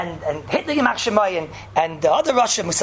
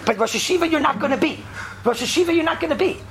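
higher-pitched, insistent retold verdict; emphatic message; 6 semitones higher than usual. Telling Rabbi Kiva he won't lead, emphatic. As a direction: imitate someone driving a blunt message home. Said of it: "such an emphatic, clear message".

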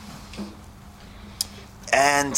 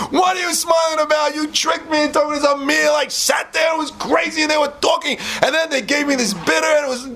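furious. Acted. Beggar yells at his friend for the prank, furious high shout. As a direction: rant at the friend who tricked him. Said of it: "and he's fuming".

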